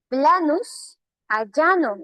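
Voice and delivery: "Planus a llano" said slowly, with a PL in planus and a palatal LL in llano; drawn-out teacher's demo of "Planus a llano". Shown as a demonstration. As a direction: show the shift from "Planus" to "llano" with each word drawn out. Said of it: "Ese planus que parece por aquí".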